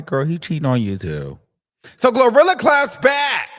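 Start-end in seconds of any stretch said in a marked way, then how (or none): 2.0-3.5 high and loud, hyping it up; excited announcement. Real, not acted. Host hyping up the clap back, excited.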